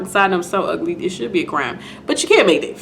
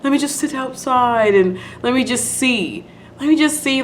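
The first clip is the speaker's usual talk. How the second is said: higher-pitched mock begging, no real tears; retold pleading; 6 semitones higher than usual. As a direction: plead lightly, imitating a desperate patient. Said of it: "She's, like, begging and crying about".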